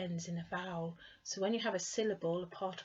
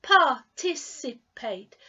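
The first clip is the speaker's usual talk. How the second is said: "Participate" slow and much louder, syllables distinct; 3 semitones higher, 10 dB louder, slower than usual. Shown as a demonstration. Teacher's final drill for learners, slow and loud. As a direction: give "Participate" a firm, slow, loud model. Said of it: "Let's try it again".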